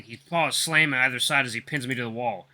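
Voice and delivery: ordinary delivery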